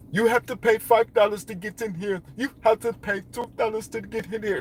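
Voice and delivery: high, put-on voice of a tourist hustler demanding fees; mock hustler voice. Imitating pyramid hustlers asking tourists for entry money, high mock voice. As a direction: play a pushy ticket seller.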